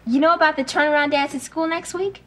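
ordinary talk, nothing marked

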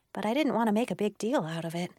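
plain reading voice

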